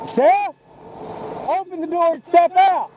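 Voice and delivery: calling from far away. Real, not acted. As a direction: project the order across the road. Officer calling to suspect at distance.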